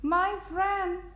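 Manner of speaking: high little voice; tiny speck's voice. A narrator's reading. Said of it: "came the voice".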